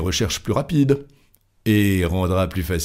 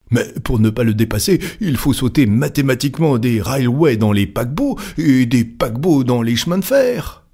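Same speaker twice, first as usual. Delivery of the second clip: high, urgent objection; agitated protest; 5 semitones higher, 3 dB louder than usual. Acted. A club member argues with Fogg, agitated. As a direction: race upward into disbelief.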